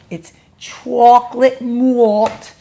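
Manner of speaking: slow drawn-out chocolate malt in a mock New York accent; imitating a New York accent on chocolate malt; northern accent imitation. Shown as a demonstration. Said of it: "that's my imitation".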